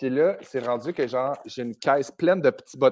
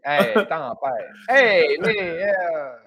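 Saguenay accent; 5 dB louder, much slower than usual. Shown as a demonstration. Showing how it returns with mom, Saguenay accent. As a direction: lay the Saguenay accent on thick and slow. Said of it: "l'accent revient tout de suite".

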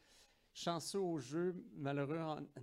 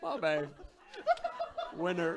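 retelling while cracking up; laughing through the story; 4 semitones higher, 6 dB louder than usual. Retold or imitated. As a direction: laugh out the embarrassing memory. Raconte son nez qui coule en riant. (en riant aux éclats)

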